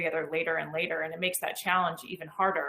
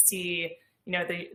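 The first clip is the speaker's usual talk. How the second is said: ordinary talk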